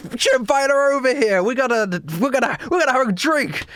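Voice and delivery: high, excited drinking-buddy chatter; exuberant Blake. Acted. Blake raves about Cleo over drinks, exuberant. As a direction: gush happily over a friend. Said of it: "but immediately Blake goes".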